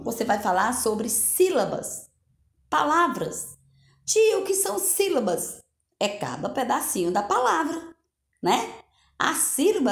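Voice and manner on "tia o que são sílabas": put-on high child's voice asking the teacher; pupil asking. Teacher plays a pupil asking her, high child voice. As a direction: play a curious little kid.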